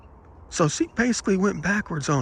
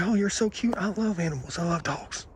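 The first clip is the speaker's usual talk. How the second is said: higher, softer gushing over a cute dog; soft pet-talk, raised pitch; cooing at dog; 3 semitones higher, 5 dB quieter than usual. Retold or imitated. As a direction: coo affectionately at a dog. Fussing over a dog he pets.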